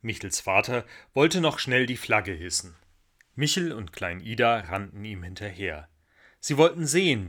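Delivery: usual speaking voice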